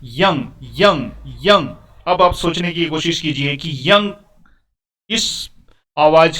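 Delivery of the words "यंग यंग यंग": "यंग" said slowly, each with a rise and fall; pronunciation demo. Shown as a demonstration. Teacher showing which sound starts the word, slow demonstration of "यंग". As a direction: sound out "यंग" with a clear opening y-glide. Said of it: "उच्चारण क्या हो रहा है".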